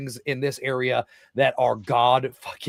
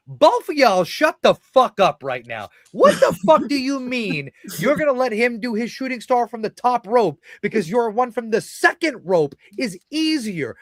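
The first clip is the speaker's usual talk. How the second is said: mock furious rant; 7 semitones higher, 5 dB louder than usual. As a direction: chew them out, the last words shouted. Retold rant at two wrestlers.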